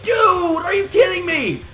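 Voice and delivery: high, shouted disbelief; disbelieving shout. Retold or imitated. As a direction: yell it at the screen in disbelief. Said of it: "I'm like".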